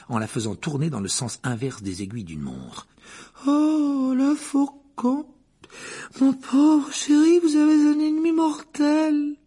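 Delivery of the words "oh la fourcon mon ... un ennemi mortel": high, slow, theatrical seer with a tragic tremble; a dramatic fortune-teller moaning over the cup; Trelawney voice. Acted. The divination teacher warns Harry, high and theatrical.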